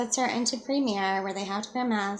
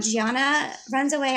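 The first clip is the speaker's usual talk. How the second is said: usual speaking voice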